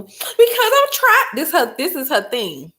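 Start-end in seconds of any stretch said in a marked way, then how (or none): 0.0-1.3 high, slow whimper; whiny crying teen. Retold or imitated. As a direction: mimic the sobbing daughter. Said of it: "daughter's sobbing reply".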